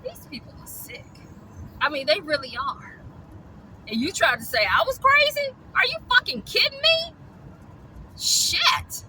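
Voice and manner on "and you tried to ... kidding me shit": high, loud, incredulous outburst; outraged disbelief. Real, not acted. Calling out rescuers who called her crazy, high outraged disbelief. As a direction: blow up in disbelief.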